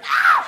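a sudden squeal far above her voice; high fangirl shriek. Retold or imitated. A fan squealing at a message from her idol.

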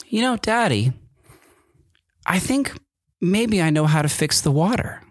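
high little-kid voice imitating his son; child voice. Dad imitating his little son offering to fix the water, high child voice. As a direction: play the small boy, bright and high.

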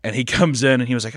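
usual speaking voice

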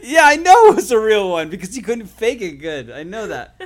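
husband teasing wife about her fake laugh, laughing loudly; laughing tease. Real, not acted. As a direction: rib her playfully while cracking up.

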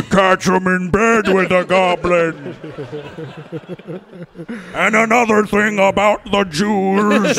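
slow, drawn-out rant voice; Alex Jones. Acted. Riffing as a seventy-foot radio ranter, slow drawn-out impression. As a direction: stretch every word like a ranting giant. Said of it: "a seventy-foot Alex Jones".

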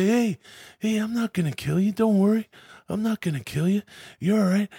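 high, softened calming voice; mock reassuring. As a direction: imitate talking down a panicked stranger. Acting out hero reassuring the captive.

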